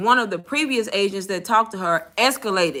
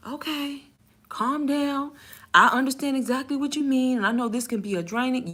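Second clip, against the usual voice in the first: soft, higher-pitched manager voice; soothing manager; 3 semitones higher, 5 dB quieter than usual. Retold or imitated. Manager calming a tired agent, soft and soothing. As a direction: imitate a boss calming someone down, gently. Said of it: "he was like".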